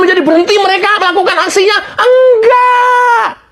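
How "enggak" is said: outraged no. Real, not acted. Host insisting attacks never stop, strained outcry. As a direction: cry out the denial.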